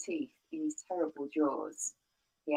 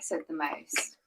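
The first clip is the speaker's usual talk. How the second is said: usual speaking voice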